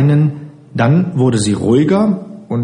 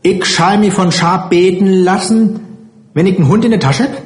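murrendes Plattdeutsch; 8 semitones higher than usual. A narrator's reading. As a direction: grumble away in broad Plattdeutsch. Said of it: "fiel murrend aus dem Französischen in ihr geliebtes Plattdeutsch". (murrend auf Plattdeutsch, mit höherer Stimme)

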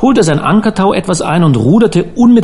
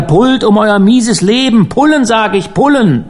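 high-pitched commanding call; urgent, insistent order to row; urgent shouted order; 7 semitones higher than usual. A narrator's reading. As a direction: drive the rowers on with raised voice. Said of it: "rief er".

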